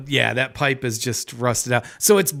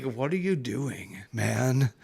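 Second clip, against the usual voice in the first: slightly slow, soft voiced cat; lightly voiced cat, low-key complaint; unimpressed cat; 3 semitones lower, 5 dB quieter, slower than usual. Cat questions owner during the flood, mildly unimpressed. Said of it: "The cat is like".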